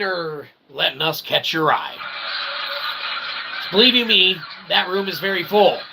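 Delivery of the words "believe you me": usual speaking voice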